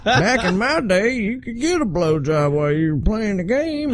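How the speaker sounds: put-on high, laughing old man voice. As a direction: cackle through it like an old geezer.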